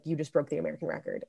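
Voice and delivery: hushed, quick imitation of a secret; retold whisper. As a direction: drop it soft and fast, imitating a word in the ear. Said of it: "she whispered in my ear".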